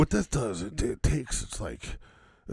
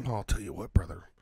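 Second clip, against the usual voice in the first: lower, softer promo voice; low mock wrestler growl; 8 semitones lower, 5 dB quieter, faster than usual. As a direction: lean in like a wrestler confiding.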